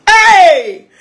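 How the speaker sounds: high, loud, drawn-out "hey"; big attention-grabbing holler; calling out. Real, not acted. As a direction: shout a long exclaiming hey. Calls out after laughing, boasting.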